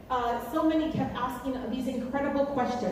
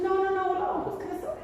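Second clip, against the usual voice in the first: dismissive mother; 7 semitones higher, faster than usual. Retold or imitated. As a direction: imitate a mother waving it all away. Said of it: "that's my mother".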